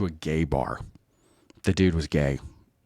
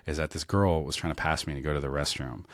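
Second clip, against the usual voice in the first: normal talking voice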